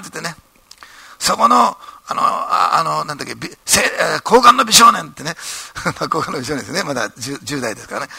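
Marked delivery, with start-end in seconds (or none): 1.2-5.1 high, loud scolding bark at Ito; boss shouting. Retold or imitated. Company president shouting at young Ito at the banquet, loud and high.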